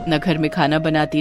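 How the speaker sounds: ordinary delivery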